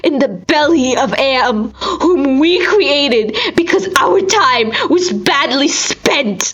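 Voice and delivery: high, strained voice close to breaking, crying through the words; anguished lament. A narrator's reading. Narrator mourning his ruined body, voice breaking with anguish. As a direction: let the grief crack through as he describes what he has become.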